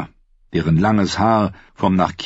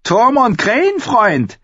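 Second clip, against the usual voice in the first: much higher, spitting taunt; a raider woman taunting the old wildling; hissing Harma; 4 dB louder than usual. Acted. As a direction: spit the insult in a high, strange voice. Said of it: "fauchte Harmer".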